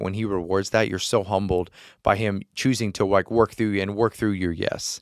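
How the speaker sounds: ordinary talk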